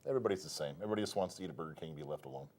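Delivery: normal talking voice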